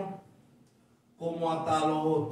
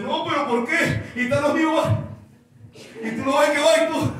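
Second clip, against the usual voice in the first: put-on scared child; 10 semitones higher, 6 dB louder than usual. Child learning to ride a bike.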